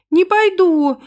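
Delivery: put-on high, loud little-girl shout; bratty shouted refusal; child's defiant yell. A narrator's reading. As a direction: yell back stubbornly in a high voice. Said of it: "заорала в ответ Ангелинка".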